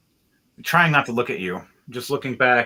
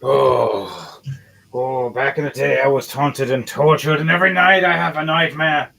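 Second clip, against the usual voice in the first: put-on raised, accented dwarf lament; loud, wailing character voice; 3 semitones higher, 3 dB louder than usual. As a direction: pour out old torments in a dwarf voice. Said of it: "He looks down at you and goes".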